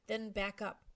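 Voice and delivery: plain reading voice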